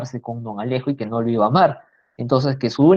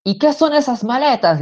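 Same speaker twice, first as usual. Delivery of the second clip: shouting mother; 10 semitones higher than usual. Acted. Mother spotting the suitcases, yelling in alarm. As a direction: bark the question at them. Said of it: "le grita".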